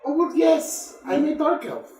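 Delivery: put-on high, innocent child voice. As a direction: answer sweetly like a child. Elf child answers.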